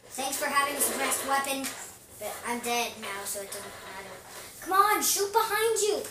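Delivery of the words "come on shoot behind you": high, loud frustrated shout; urgent shout. Real, not acted. Player shouting at spectated teammate, urgent yell.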